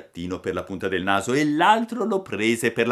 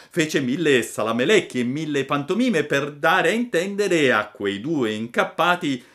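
ordinary delivery, nothing marked

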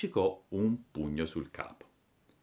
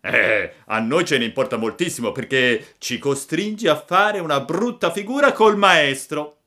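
louder, animated scolding of a classmate; schoolboy bully scolding; 7 semitones higher, 7 dB louder than usual. Acted. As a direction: shame him like a resentful schoolmate. Boys scolding Pinocchio for studying. (monello che rimprovera)

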